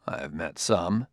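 cautious. A narrator's reading. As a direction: answer carefully, holding back. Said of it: "said Bailey cautiously".

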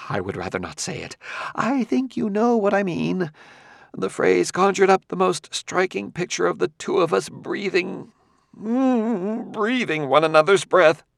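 lightly voiced squeamish scholar; disgusted scholar. A narrator's reading. Quemot disgusted.

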